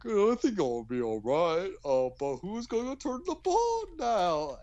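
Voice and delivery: a goofy worried sidekick asking a question; silly cartoon character voice. Recapping the episode to a friend, a lightly voiced worried character.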